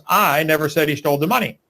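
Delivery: "I" punched at the start, rest plain; stress on I. Shown as a demonstration. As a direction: lean hard on the first word, "I". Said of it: "By just emphasizing different words".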